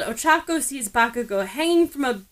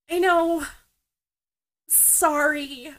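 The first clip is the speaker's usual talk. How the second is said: quick, higher blurt; blurted apology; 6 semitones higher, slower than usual. A narrator's reading. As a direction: spill out the apology in a rush. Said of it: "Ochako blurts".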